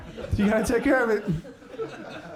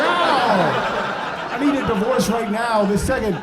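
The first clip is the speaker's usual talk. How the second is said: hammed-up, raised-pitch outburst; outraged wife; 5 semitones higher, 4 dB louder, slower than usual. Wife demanding a divorce from husband, outraged. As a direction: wail the demand like a fed-up spouse.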